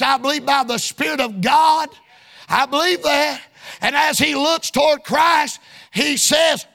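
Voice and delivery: ordinary delivery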